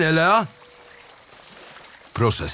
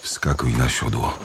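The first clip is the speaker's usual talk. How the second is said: lower, altered voice; changed voice; 11 semitones lower than usual. A narrator's reading. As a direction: speak in a changed, deep voice. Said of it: "powiedział wreszcie zmienionym głosem".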